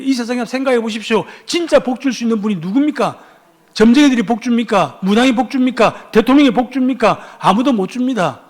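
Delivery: high, forceful rhetorical questioning; impassioned preaching. Real, not acted. Preacher asks congregation who can truly bless, impassioned preaching. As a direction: fire challenges at the congregation.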